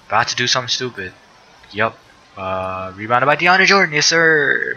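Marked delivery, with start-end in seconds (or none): 3.0-4.8 pitched up, louder cheer for the rebound; delighted gamer cheering his player; excited cheer. Real, not acted. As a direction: hype up the big man's rebound.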